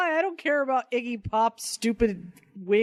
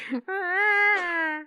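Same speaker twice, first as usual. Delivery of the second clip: high, cracked bird screech; condor call; 9 semitones higher than usual. Retold or imitated. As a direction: squawk it like a condor. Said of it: "Is that what a condor sounds like?".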